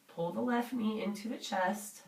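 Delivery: ordinary delivery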